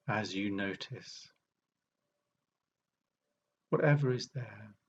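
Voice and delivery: unhurried meditation guide with long pauses; guided meditation. Acted. Meditation teacher leading an arrival sit, calm guiding voice. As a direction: guide listeners gently, leaving long silences between phrases.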